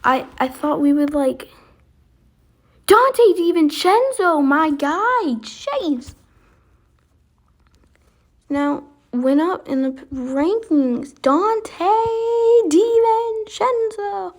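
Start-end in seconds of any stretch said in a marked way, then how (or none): 2.9-6.1 excited hype. Real, not acted. Hyping his favourite player. 11.2-14.3 excited drawn-out name. Real, not acted. Drawing out the player's name.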